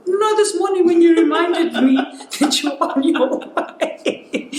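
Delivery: high, loud punchline dissolving into laughter; laughing mom imitation. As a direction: play mom's answer while cracking up.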